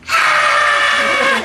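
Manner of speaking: loud high scream of fright; startled scream. Retold or imitated. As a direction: yell as if caught in the act. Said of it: "And we all went".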